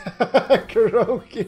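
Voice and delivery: normal talking voice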